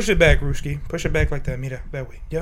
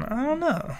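higher, softer drawn-out shrug; Rudy's way; 9 semitones higher, 9 dB quieter than usual. Shown as a demonstration. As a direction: show how Rudy shrugs it off. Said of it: "say it with the fucking".

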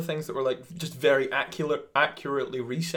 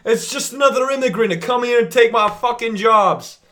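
angry rant; 10 semitones higher, 11 dB louder than usual. Acted. Comic rant, shouted.

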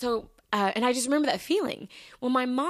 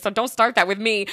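louder, sassy pushback; indignant retort; 8 dB louder than usual. Retold or imitated. Arguing with the doctor's office over lateness, indignant. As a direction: push back hard, mock warning.

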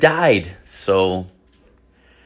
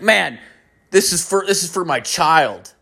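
higher-pitched imitation of a protesting friend; pleading buddy; 6 semitones higher than usual. Buddy excusing himself over his child, higher pleading voice. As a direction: plead in a raised voice.

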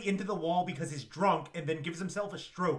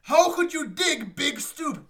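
high, slow and louder; hammed-up quote; 9 semitones higher, 8 dB louder, slower than usual. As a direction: overact the line. Quoting film dialogue.

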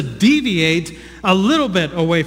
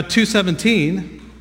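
ordinary delivery

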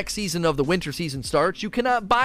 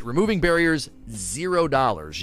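ordinary delivery

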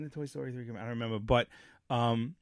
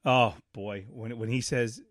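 ordinary delivery

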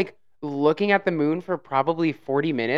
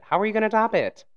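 much higher, faster excited repeat; 8 semitones higher, faster than usual. Real, not acted. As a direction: squeal it the second time.

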